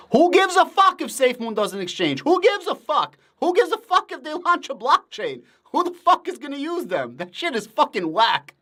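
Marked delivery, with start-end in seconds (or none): 0.1-7.2 exasperated rant. Real, not acted. Streamer to SafeMoon fans in chat, exasperated high outburst. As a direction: throw it up in disbelief.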